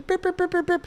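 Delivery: very high, soft beep-beep babble; mock beeping chatter. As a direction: make her nagging sound like a silly beeper.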